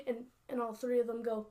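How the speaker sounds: usual speaking voice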